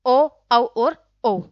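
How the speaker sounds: ओ, औ और ओ drawn out slowly with rounded lips; teacher sounding out rounded vowels ओ, औ, ओ; slow rounded vowel demo. Shown as a demonstration. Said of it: "कौन कौन से व्रतामुखी".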